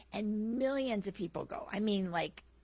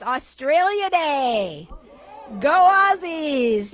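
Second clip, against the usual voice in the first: loud, high, drawn-out rallying cry; celebratory cheer; 7 semitones higher, 12 dB louder, much slower than usual. Real, not acted. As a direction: cheer on your team with stretched words. Cheering Australia Day to the congregation.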